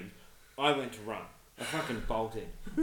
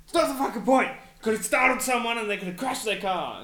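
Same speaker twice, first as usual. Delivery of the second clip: much higher, louder mock parental shout; imitating the parents yelling him down; angry yell; 12 semitones higher, 12 dB louder than usual. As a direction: yell it like furious parents scolding. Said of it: "they're like".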